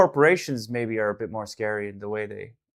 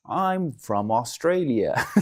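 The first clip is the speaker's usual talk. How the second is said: put-on slow, hammy Aussie accent; bad Australian accent; much slower than usual. Streamer mocks his own failed Aussie accent, slow and hammy. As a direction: stretch it out like a clumsy Aussie. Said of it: "That was terrible".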